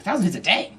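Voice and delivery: acted disbelief at the figure; amazed coworker. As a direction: echo it back astonished.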